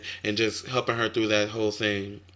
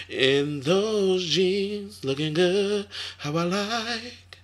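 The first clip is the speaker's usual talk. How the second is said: flirty swoon; 9 semitones higher, much slower than usual. Acted. Swooning over [name]'s jeans.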